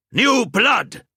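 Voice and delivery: high, loud tavern cheer; rowdy chorus shout. Acted. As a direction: bellow it back as a group toast. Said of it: "they chorused".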